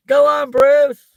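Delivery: very high, loud drawn-out cheer; cheering shout. Acted. Egging Bruce on in the Matilda cake scene, cheering. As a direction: yell encouragement across a crowd.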